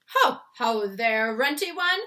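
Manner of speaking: loud, slow, booming giant jeer; bully giant sneering, loud; taunting giant. A narrator's reading. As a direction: roar the mockery down at him. Flesh lump eater taunting.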